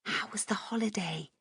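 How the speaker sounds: soft unvoiced whisper; mouthed whisper. A narrator's reading. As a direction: breathe it silently across to her.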